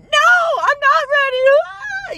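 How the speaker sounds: loud, high-pitched hammed-up wail; mock crying outburst. Imagined pregnant teenager breaking down at the store employee, mock crying. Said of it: "what if I broke down crying".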